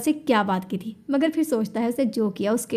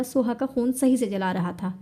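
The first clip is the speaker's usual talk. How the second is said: ordinary delivery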